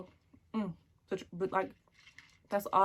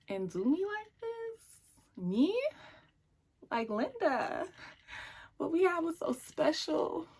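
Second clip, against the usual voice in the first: high, drawn-out theatrical indignation; hammed-up wounded outrage, slow and rising; dramatic hurt disbelief; 5 semitones higher, 3 dB louder, slower than usual. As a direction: act out a betrayed best friend, stretching the words. Acts out her hurt at betrayal.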